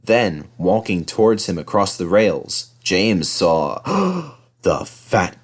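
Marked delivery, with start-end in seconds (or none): none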